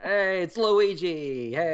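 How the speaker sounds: high, slow cartoon plumber voice; Luigi. Answering how the plumber will sound, high cartoon voice. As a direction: stretch it out high like a cartoon hero. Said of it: "how is his Luigi gonna sound".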